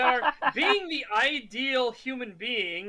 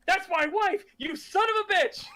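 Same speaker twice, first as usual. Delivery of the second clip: mock outrage; 9 semitones higher than usual. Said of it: "and he goes".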